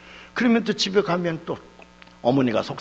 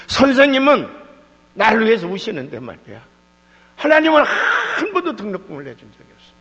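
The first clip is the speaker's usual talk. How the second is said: loud, high-pitched protest; impassioned preacher's complaint, voice raised high; indignant outcry; 5 semitones higher, 8 dB louder than usual. Real, not acted. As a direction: cry out the grievance at full force. Indignant complaint against God.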